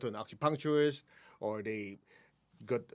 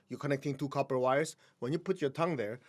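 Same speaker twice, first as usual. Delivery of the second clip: normal talking voice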